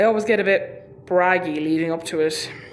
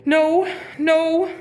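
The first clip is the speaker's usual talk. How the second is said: 1 panicked denial; 9 semitones higher, 3 dB louder, much slower than usual. Acted. Insisting fine during panic.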